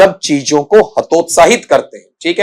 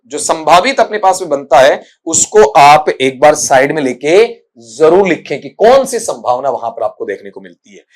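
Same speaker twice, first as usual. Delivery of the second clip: ordinary talk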